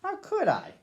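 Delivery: calm first-person storybook reading as the dog Duke; story narration. A narrator's reading. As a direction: read it gently to children, as the dog telling his own tale.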